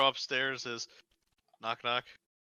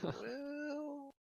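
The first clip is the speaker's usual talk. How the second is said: high, soft, drawn-out hedge; meek; 12 dB quieter than usual. Acted. Clerk answering his furious boss, meek. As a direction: shrink back with a tiny reply. Clerk stammers under scolding.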